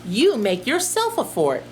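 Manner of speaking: ordinary talk, nothing marked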